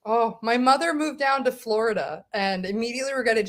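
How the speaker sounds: normal talking voice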